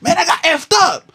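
high-pitched, loud mock old man calling out. Old man in a wheelchair calling out to the runner, high and loud. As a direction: yell like a grumpy old man flagging someone down. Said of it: "there's this old man".

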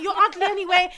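high, loud comeback; retold retort. Woman answers stall uncle's insult, retold loud retort. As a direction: hurl back an insult, imitating the row. Acting out the woman shouting back.

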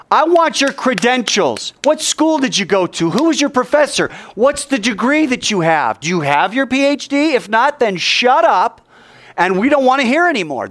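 mock indignant demand. Preacher voicing sneering priests demanding Jesus's credentials, pushy and indignant.

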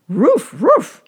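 high, loud bark-like "Roof"; dog barking words. A narrator's reading. Rover answers his master's question, barking high and loud. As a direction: bark each word out like a dog.

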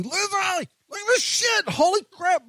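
high, drawn-out mock yell; strained high holler without full volume; retold yelling. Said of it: "I'm just yelling at Lindsay".

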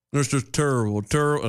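lightly voiced celebrity impression; a hint of a sports commentator's catchphrase; Charles Barkley. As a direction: do a light, half-committed impersonation. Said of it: "the only part of him I can imitate".

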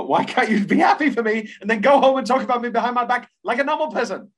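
high, loud, indignant plea; exasperated outburst. Acted. Quoting a woman upset at her friend, exasperated outburst.

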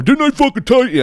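high, loud sneering boss voice; mocking angry boss. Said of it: "I don't wanna hear my boss going".

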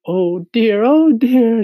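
high, loud insect chorus voice; amused exclamation. A narrator's reading. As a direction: exclaim loudly in a high character voice. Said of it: "There was a roar of laughter".

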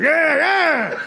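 high, loud whoops of delight; hammed-up cries from a man having a great time; ecstatic yelling. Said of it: "he's having a great time".

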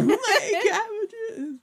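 very high, slow crying wail; cabbage merchant. Retold or imitated. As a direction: sob it over the ruined cabbages. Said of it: "It's the cabbage man from Avatar".

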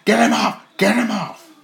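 high, loud plea for release; hammed-up high-pitched cry; acted desperate yell. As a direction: yell for help as the pinned wrestler. Voicing trapped wrestler begging for release.

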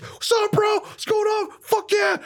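hyped bro greeting. Retold or imitated. Darby hypes up the stranger.

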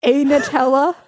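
mock the clumsy order through laughter; mock translation. Contestant teasing the host's French order, loud and laughing. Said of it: "so you just said".

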